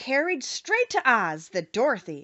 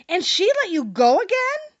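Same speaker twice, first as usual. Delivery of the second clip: higher, louder amazed question; wondering; 10 semitones higher, 7 dB louder than usual. A narrator's reading. Gatekeeper amazed the Witch let them go, wondering. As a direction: marvel at the news. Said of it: "asked the man in wonder".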